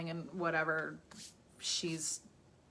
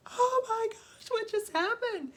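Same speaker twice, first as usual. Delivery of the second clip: put-on much higher, slower, alarmed boy's voice; startled Harry; 4 dB louder than usual. Harry watching the bird burst into flames, high startled voice. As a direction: squeal it like a shocked kid. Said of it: "And Harry's like".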